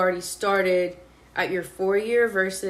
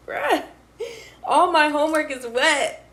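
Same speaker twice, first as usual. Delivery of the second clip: laughing complaint; 6 semitones higher, 4 dB louder than usual. Retold or imitated. Complaining about rain, laughing.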